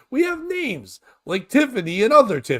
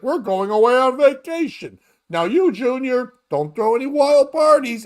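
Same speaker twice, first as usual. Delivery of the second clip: rich parent warning; 4 semitones higher, 4 dB louder than usual. Retold or imitated. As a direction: lecture Junior like an eighties movie dad. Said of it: "Rich people are like".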